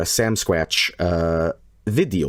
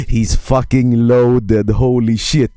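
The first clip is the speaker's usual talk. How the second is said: slow, louder mock awe; quoting Chad; 7 dB louder, slower than usual. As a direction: drawl it out like a stunned friend. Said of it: "and Chad's saying".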